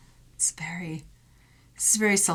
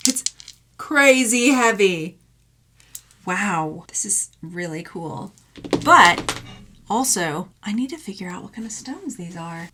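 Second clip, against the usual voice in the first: ordinary talk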